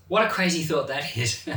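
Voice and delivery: normal talking voice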